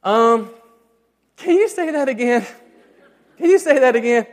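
mock sheepish, caught not listening; sheepish ask. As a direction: play the distracted dad begging a repeat. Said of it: "that's when I have to just say".

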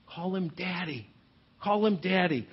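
soft, hushed Call him Daddy; tender invitation. Retold or imitated. Jesus telling religious people to call God Daddy, softly. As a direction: invite them gently and intimately. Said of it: "here's Jesus saying".

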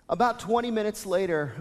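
ordinary delivery

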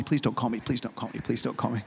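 the same plea, fading to near silence. Retold or imitated.

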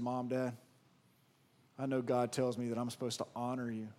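soft, humble apology to mom and dad; hushed and sorry, like a child owning up; quiet apology to parents. Pretend. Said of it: "you said".

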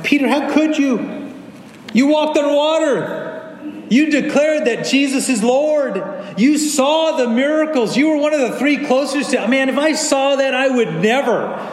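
mock indignation. Mock judging of Peter.

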